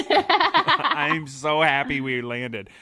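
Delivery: laughing through the words, slow and high. Real, not acted. Telling a friend about landing, laughing slowly. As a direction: giggle it out, drawn out.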